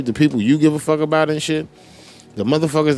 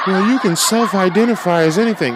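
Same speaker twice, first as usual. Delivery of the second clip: higher, prissy voice of an imagined critic; mock critic voice; 7 semitones higher than usual. Arguing with an imagined critic, in a mocking higher voice. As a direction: parrot the smug objector.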